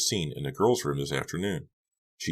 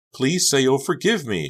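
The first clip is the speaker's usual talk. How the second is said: high, louder pleading; begging; 10 semitones higher, 6 dB louder than usual. A narrator's reading. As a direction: beg her friend to forgive you. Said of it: "she begged".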